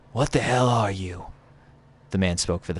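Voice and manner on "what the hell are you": pitched-up stranger's voice; Drifter impression. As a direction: play the rough-living wanderer, higher. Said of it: "I'm gonna do my Drifter impression".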